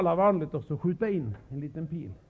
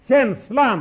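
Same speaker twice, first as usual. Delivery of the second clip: punched out, higher and louder; emphatic exclamation; 5 semitones higher, 7 dB louder than usual. Real, not acted. Preacher exclaiming feeling to the hall, emphatic. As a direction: throw the word out.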